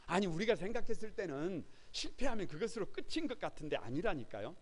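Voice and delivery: ordinary delivery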